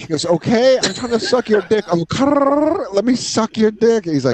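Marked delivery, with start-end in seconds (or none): 0.2-1.8 high, whiny pleading voice; pitched-up whiny imitation of Jacob; squeaky mock plea. 1.9-2.9 bird noise. Retold or imitated. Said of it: "why are you doing bird noises?". 2.9-4.0 high, whiny pleading voice; pitched-up whiny imitation of Jacob; squeaky mock plea.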